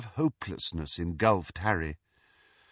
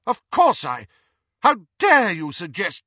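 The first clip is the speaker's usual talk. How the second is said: indignant, raised higher and louder; heated; 8 semitones higher, 8 dB louder than usual. A narrator's reading. Ghost replies to Harry's accusation, heated and indignant. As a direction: protest an insulting suggestion. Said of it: "her voice was heated".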